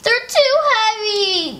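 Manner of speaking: high, slow, whiny mock wail. Beatrice wails to Henry about the heavy books, mock crying. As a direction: whimper like an overloaded little kid. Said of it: "Beatrice wailed".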